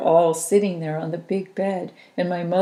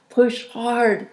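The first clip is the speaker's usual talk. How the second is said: higher, louder urging; coaching; 5 semitones higher, 5 dB louder than usual. A narrator's reading. Helpers urge the mother to push, loud. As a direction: push her on, firm and loud. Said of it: "they said".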